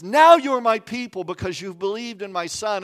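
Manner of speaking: loud, lifted divine declaration; voice of God. Acted. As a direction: proclaim it as God speaking. God voiced speaking to believers.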